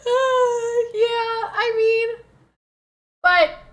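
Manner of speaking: loud, high laughing; laughing hard. Real, not acted. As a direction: crack up laughing. Bursts out laughing at chat.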